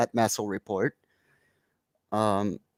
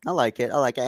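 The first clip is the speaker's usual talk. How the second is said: ordinary delivery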